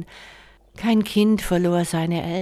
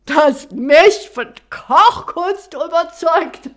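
loud, high, slow scoff; scornful warlord; 11 semitones higher, 10 dB louder, slower than usual. Acted. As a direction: scoff in disbelief, then laugh.

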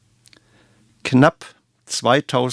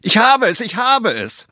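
excited call; 12 semitones higher than usual. Acted. The cook calls to his son Franz, thrilled and high. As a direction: call out to the boy thrilled by the idea. Cook's eureka moment.

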